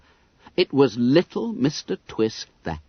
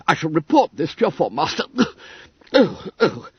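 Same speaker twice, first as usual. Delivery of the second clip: high, breathless fussy little man; gurgling winded secretary; 5 semitones higher, faster than usual. Acted. Little secretary knocked flat, spluttering high and breathless. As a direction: splutter like a winded old clerk. Twiss threatening.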